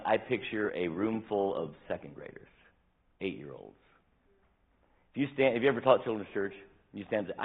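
ordinary delivery

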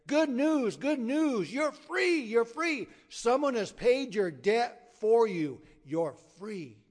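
high, loud acted announcement of good news; joyful proclamation. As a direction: proclaim it like a bailiff freeing a prisoner. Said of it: "and the bailiff declared".

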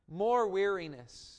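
usual speaking voice

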